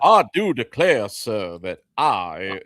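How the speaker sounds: a Southern colonel with a gruff voice; Southern accent attempt. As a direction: play a blustering Southern colonel. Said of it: "trying to do a, a Southern accent".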